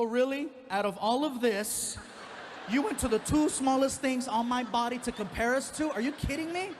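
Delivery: high, loud incredulous outrage; indignant outrage. Retold or imitated. Comic to coworker about his eyes, loud indignant disbelief. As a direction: blow up in mock offense.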